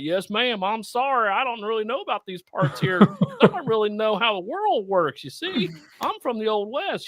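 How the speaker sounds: high, timid apologetic cowboy; meek mock cowboy. Gunslinger Spawn apologizing to the housekeeper, meek and high. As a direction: cower like a lost gunslinger. Spawn voiced meekly in retold scene.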